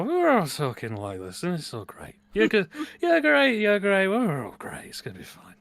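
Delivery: hammed-up tipsy slur, swooping high then dropping away; slurred drunk. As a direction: imitate a happy drunk mushing words together. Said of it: "Booze is a great language equalizer".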